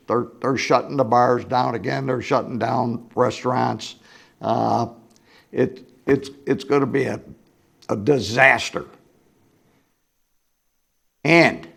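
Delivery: ordinary talk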